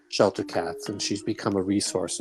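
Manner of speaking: normal talking voice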